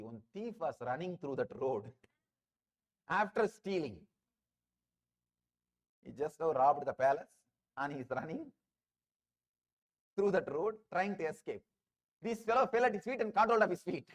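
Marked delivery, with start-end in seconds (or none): none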